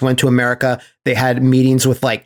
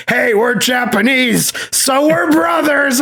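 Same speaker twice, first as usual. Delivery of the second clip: much higher, teary hammed-up brotherly plea; mock E Honda; 9 semitones higher than usual. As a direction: gush it like a weepy sentimental wrestler. Retelling E Honda's line.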